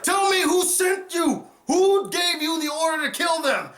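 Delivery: the fighter screaming at his foe to name who sent him; shouted demand. Retold or imitated. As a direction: yell it toward the royal box. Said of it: "And he's like".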